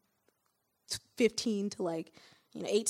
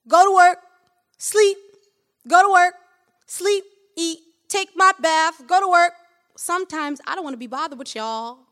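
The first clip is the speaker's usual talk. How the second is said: put-on loud, stern father lecturing his kids; voice of my dad; 5 semitones higher, 17 dB louder, slower than usual. Reading her poem in her dad's voice, loud and stern. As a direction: scold them like a tired, proud dad. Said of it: "in the voice of my dad".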